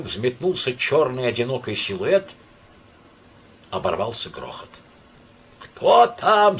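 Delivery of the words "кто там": high, loud booming demand; thundering shout. A narrator's reading. Myshlaevsky thunders at the door, loud shout. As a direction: thunder it like a sergeant. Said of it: "загремел Мышлаевский".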